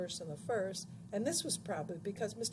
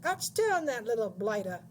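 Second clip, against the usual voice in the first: loud screech starting high and falling; shrieking; 3 semitones higher, 7 dB louder than usual. A narrator's reading. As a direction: crow it out in a screech. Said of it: "shrieked Missus Pratchett".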